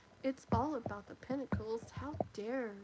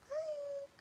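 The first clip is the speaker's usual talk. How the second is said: a high, sweet hi; delighted hi; 4 dB quieter than usual. Real, not acted. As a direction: greet them brightly, way up high. Greeting a returning viewer.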